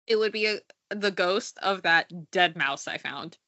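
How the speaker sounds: ordinary talk, nothing marked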